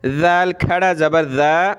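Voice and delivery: ज़ा as a long "dhaa", tongue between teeth; tongue-out, stretched. Shown as a demonstration. Teacher demonstrating the rule, interdental lengthened ज़ा. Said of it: "ज़बान निकालेंगे और एक अलिफ".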